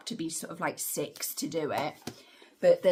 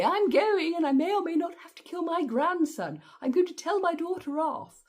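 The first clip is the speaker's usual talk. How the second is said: much higher, louder scheming granny voice; mocking grandma; 12 semitones higher, 4 dB louder than usual. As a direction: play her as a bossy granny off to scold her daughter. Voicing grandma Helen's plan.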